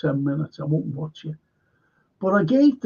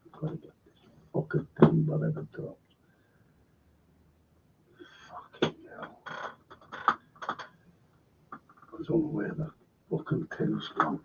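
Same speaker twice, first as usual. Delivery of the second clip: muttering under breath; 7 semitones lower, 8 dB quieter than usual. Real, not acted. Searching for dropped thing, muttering.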